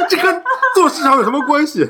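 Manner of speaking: high, loud laughing question; real laughter breaking through the words. Real, not acted. Incredulous while laughing at friend.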